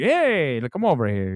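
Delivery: mock a movie-Italian accent coming back; big House of Gucci Italian accent; Adam Driver accent. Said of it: "remember in House of Gucci?".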